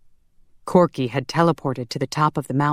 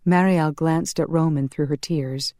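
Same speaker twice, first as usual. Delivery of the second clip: ordinary delivery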